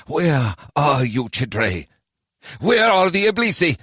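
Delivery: slow, longing call; anxious plea. A narrator's reading. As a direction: ask the air where they are. Sajay wonders anxiously.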